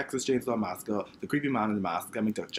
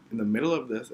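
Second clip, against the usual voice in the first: ordinary talk, nothing marked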